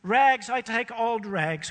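high, loud, slow hawker's call; the sobbing ragman cries his trade aloud; crying out. A narrator's reading. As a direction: call it out down the street while grieving. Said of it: "cried the sobbing".

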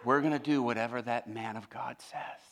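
hushed, mock-reverent awe, dropping into a whisper; tearfully moved. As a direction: play overcome with soft, tearful admiration. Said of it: "Tears began to flow down his face".

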